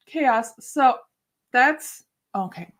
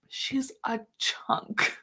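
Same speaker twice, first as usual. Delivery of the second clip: whispered aside; 8 dB quieter, much slower than usual. Real, not acted.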